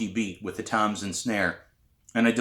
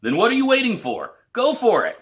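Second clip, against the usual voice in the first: higher, louder push to get going; impatient teacher; 13 semitones higher, 6 dB louder than usual. A narrator's reading. Teacher urging a frozen student to start, impatient. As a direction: egg her on impatiently. Pete pushes her to play.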